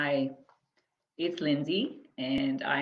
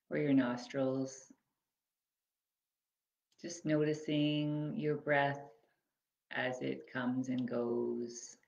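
measured, spacious phrases that leave room to breathe; guided body scan meditation. Acted. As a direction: guide them gently through the body, unhurried.

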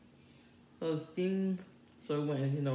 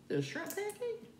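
surprised. Pretend. Mock confused reaction to taste.

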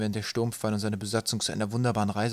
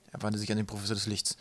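ordinary delivery

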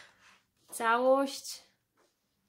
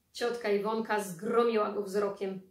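ordinary delivery

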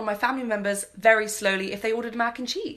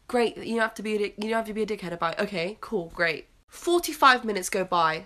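ordinary talk

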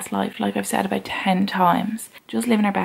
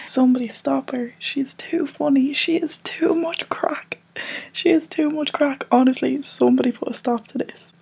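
high put-on voice, part whispered; mock outrage; 6 semitones higher than usual. Vlogger mocking herself as too funny, high put-on outrage. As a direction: protest in a high, hammed-up voice.

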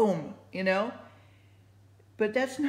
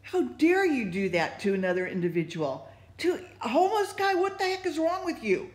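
climbs much higher and faster in indignation; retold outrage; 6 semitones higher than usual. Scolding the men for the homeless guy, indignant. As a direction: demand an answer, outraged. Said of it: "Man, I was like".

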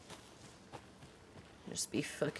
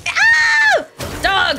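startled shout; 13 dB louder than usual. Real, not acted. Wolf jumps out.

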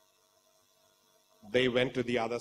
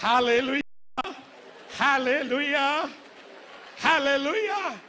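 preacher's hallelujah; 11 semitones higher, 5 dB louder than usual. Acted. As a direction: call it out to lift the congregation.

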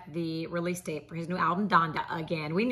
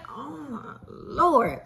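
exasperated sigh; 6 semitones higher, much slower than usual. Real, not acted. Exasperated after the interruption.

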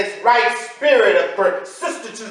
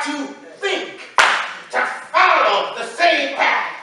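rhythmic preaching; 4 semitones higher than usual. Real, not acted. Preacher calling out party after party in a rising preaching cadence. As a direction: ride the repetition like a refrain.

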